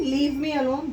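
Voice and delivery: ordinary talk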